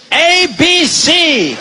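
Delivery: very slow, high sing-song A B C; very slow spelled-out alphabet; mock teacher. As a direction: drill letters like a pompous tutor. Teaching English, slow, odd voice.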